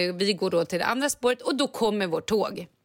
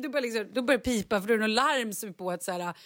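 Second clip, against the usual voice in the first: ordinary talk, nothing marked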